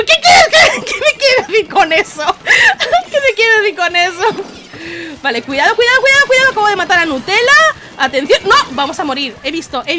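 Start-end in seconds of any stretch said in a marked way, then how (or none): 0.0-4.6 high, loud and laughing through the words; laughing fake indignation; mock outraged reply. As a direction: play it offended while cracking up. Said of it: "Y yo".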